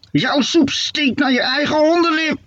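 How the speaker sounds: very high mock-shriek in a witch voice. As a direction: squeal the insult like an old hag. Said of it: "gilde Hoppakee". (hoge gillende heksenstem)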